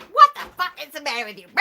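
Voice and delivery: high, fast mock tirade; imitated angry outburst. Imitating mother swearing at oldest son, mock fury. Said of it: "She was swearing at Frank".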